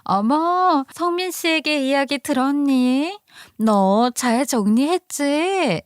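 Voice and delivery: high-pitched, drawn-out fake sweetness; coy, high voice with stretched endings; two-faced best friend. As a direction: play the scheming friend in a high voice. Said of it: "그녀는 이러더라고요".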